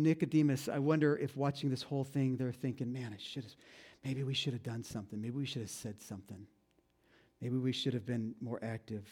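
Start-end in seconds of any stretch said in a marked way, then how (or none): 2.9-9.1 hushed, regretful musing to themselves; quiet inner regret. Retold or imitated. Two followers second-guessing themselves, quietly regretful. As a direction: murmur it like a private confession.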